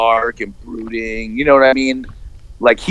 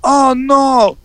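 high, drawn-out mock dismay; hammed-up damsel in distress; hammy distressed girl; 11 semitones higher, much slower than usual. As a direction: wail it like a bad actress. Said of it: "puts their hand on the face and goes".

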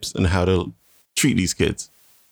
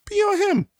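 very high comic squeak; high silly payoff; squeaky punchline. Acted.

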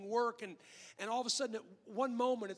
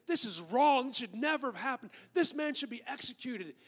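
loud, raised outburst of moral outrage; acted righteous indignation; indignant; 3 semitones higher, 7 dB louder than usual. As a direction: pronounce judgement loudly, as the outraged king. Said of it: "he is just indignant".

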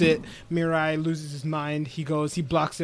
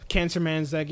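ordinary talk, nothing marked

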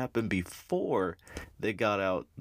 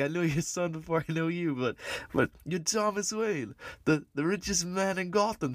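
put-on New York mugger accent; 8 semitones higher than usual. Mugger in the alley greeting the Waynes, in a high New York accent. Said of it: "a New Yorkin accent".

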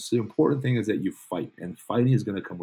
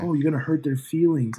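mocking whine; 7 semitones higher, 4 dB louder than usual. Mocking the soft critics.

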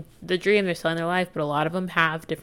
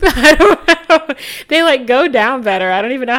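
laughing; 7 semitones higher, 9 dB louder than usual. Real, not acted. Vlogger laughing at her own smoothie logic, high and giggly. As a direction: giggle through it, much higher and louder.

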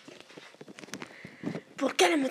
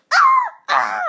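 screams; 14 dB louder than usual. Acted. Watching the movie, screaming at the blasts. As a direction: scream twice at the explosions.

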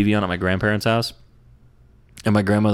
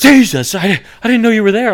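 much higher, louder startled yelp; startled wife; 13 semitones higher, 9 dB louder than usual. Retold or imitated. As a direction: imitate the wife jumping out of her skin. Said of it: "She goes".

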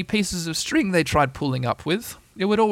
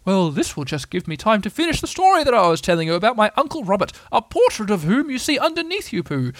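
higher pompous storytelling owl; long-winded Owl; 7 semitones higher than usual. Acted. Owl launching into his uncle's story, pompously. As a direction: ramble on grandly as the old owl.

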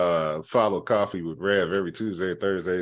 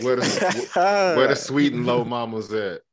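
playful call out; 4 semitones higher, 4 dB louder than usual. Acted. Joking call out.

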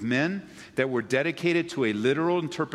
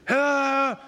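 exasperated parent's call; 13 semitones higher, 5 dB louder than usual. Retold or imitated. Parent calls the other kid.

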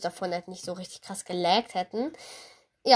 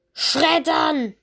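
loud high battle cry; excited shout; 6 semitones higher, 6 dB louder than usual. Real, not acted. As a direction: call it out like a war cry. (aufgeregter Schlachtruf)

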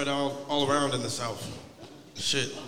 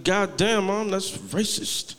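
shocked protest; 7 semitones higher, much slower than usual. Retold or imitated. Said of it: "It's like".

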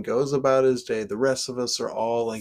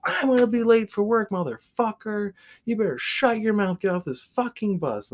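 high, fast angry yell; mock angry commuter; 11 semitones higher, 4 dB louder than usual. Late commuter yelling at the shoeless man, high and fast. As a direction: rush out the rage, pitched way up.

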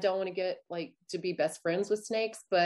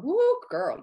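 excited exclamation; 9 semitones higher, 6 dB louder than usual. Real, not acted. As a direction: whoop it out like you're warning a friend. Telling about big Southern cockroaches.

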